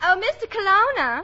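put-on Southern accent. Secretary approaches the studio boss, Southern accent. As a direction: call to him in sweet Southern tones. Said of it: "a girl with a Southern accent".

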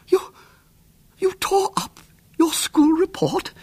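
high, slow, whispered horror; horrified whisper. A narrator's reading. Horrified aunt whispering at William's deed. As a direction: breathe it out aghast. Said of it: "face registered blank horror".

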